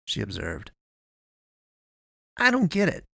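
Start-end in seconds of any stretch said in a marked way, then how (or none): 2.4-3.0 high-pitched baffled complaint; exasperated mutter. A narrator's reading. Eddie throwing up his hands at Avery, exasperated. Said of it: "he muttered, throwing up his hands".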